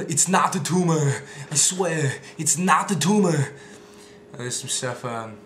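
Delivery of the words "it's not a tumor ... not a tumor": louder, slow Schwarzenegger insistence; Arnold impression. Arnold denying tumor to kids, loud impression. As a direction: insist like Arnold in Kindergarten Cop. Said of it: "those are my Arnold impressions".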